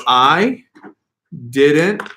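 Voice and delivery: one word at a time with long pauses; slow, separated dictation. Shown as a demonstration. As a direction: spell out the sentence word by word, a full pause after each. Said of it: "the words were".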